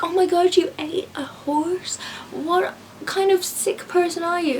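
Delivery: high-pitched, scandalised mimicry; mock horror. Mimicking people shocked at horse-eating, mock horror. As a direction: imitate someone appalled, slowing for emphasis.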